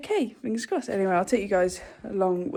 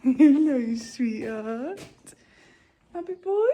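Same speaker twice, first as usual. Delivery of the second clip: cooing pet talk; 5 semitones higher, 3 dB louder, slower than usual. Real, not acted. Cooing to her horse.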